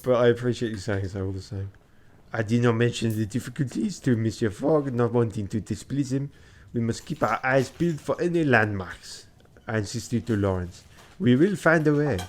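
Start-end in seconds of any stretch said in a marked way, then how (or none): 2.3-12.2 put-on lightly French-accented storyteller; French-accented reading. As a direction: tell it with a touch of French. Reads story and dialogue in French accent.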